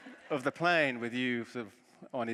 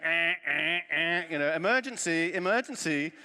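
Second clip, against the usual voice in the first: mock alarm, higher and louder; 6 semitones higher, 8 dB louder than usual. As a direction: blare it like an alarm in the head. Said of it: "my brain was just going".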